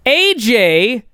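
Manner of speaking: ordinary delivery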